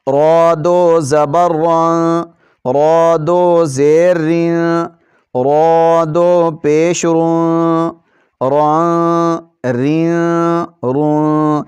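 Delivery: را said heavy, slow and full. Shown as a demonstration. Teacher showing pupils the heavy reading of را. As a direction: draw out را with a thick, heavy sound. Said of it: "را ... اس کو موٹا پڑھتے ہیں".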